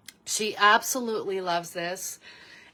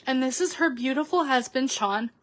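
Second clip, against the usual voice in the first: normal talking voice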